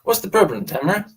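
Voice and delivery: put-on echoes the line back in his natural accent; playful echo in John's own accent. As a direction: say it the way he really said it. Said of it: "See how he said that?".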